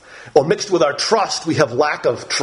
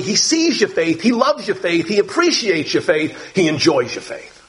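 ordinary talk

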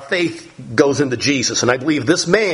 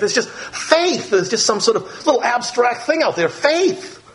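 mocking vague faith; 7 semitones higher than usual. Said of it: "they don't tell you what they have faith in".

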